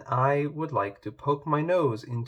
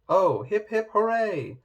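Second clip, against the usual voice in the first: cheering; 8 semitones higher, 3 dB louder than usual. Acted. Animals cheer for bread.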